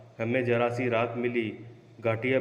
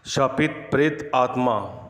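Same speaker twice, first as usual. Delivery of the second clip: title announcement; 4 dB louder, slower than usual. A narrator's reading. Story title announced after pause.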